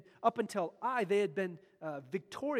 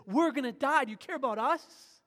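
loud, high, pleading cry; desperate appeal; 5 semitones higher, 10 dB louder than usual. Acted. Joshua's desperate appeal to God's heart, loud plea. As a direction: beg God to care. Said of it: "makes a desperate appeal to God's heart".

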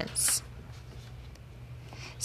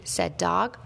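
usual speaking voice

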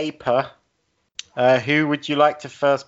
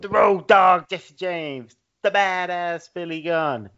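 loud, higher ring announcer call; ringmaster; 7 semitones higher, 4 dB louder than usual. Retold or imitated. Reciting entrance intro, loud.

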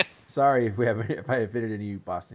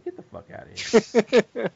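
mock tough Boston; 12 semitones higher, 9 dB louder, slower than usual.

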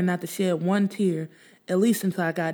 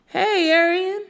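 higher, louder call of a neighbor; 13 semitones higher, 6 dB louder, slower than usual. Acted. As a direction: call down to her from afar. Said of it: "my neighbor called down to me".

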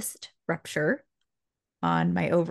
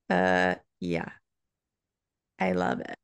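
husband's low joke; 12 semitones lower than usual. Retold or imitated. Husband's voice, joking now.

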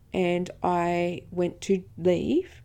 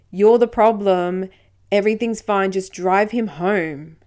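officer scolding; 9 dB louder than usual. Retold or imitated. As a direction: bark it down at her like an angry officer. Police officer berating her.

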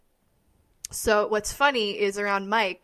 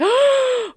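loud, high, drawn-out gasp; amazed gasp; 7 dB louder than usual. Retold or imitated. Coach video fan reacting to a discovery, big amazed gasp. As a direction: gasp in mock revelation.

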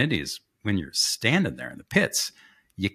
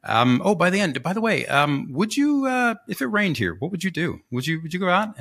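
mock casual question; 8 semitones higher than usual. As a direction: slip it in sweetly, innocently. Friend casually asking drivers.

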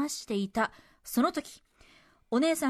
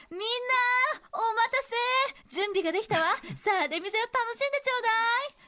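high, theatrical dubbed-movie heroine; loud announcement; 9 semitones higher, faster than usual. Acted. Cinema staffer telling customers the film is ready, loud announcement. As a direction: sing out the good news to the whole crowd, hammed up like a dub. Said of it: "と大きな声で言いました".

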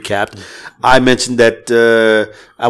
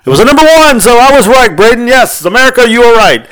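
high, loud gloating proclamation; game-show style victory call; triumphant announcement; 10 semitones higher, 5 dB louder than usual. Real, not acted. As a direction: crow about being right. Announcing the poll result.

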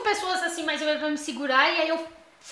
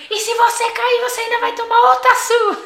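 high, louder threatening mom voice, laughing; mock scolding mom; 9 semitones higher, 7 dB louder than usual. Imitating her mother threatening another beating, high and loud.

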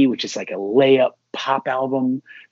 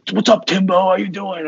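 a lightly voiced cheerful dad greeting; bright, higher dad hello; playing his dad picking up the phone; imitating dad; 7 semitones higher than usual. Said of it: "he always calls me Timbo".

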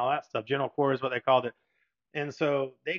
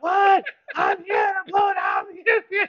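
screaming into the headset; 8 dB louder than usual. Retold or imitated. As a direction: shout it through the noise of the engine room. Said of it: "you're, like, screaming to the guy".

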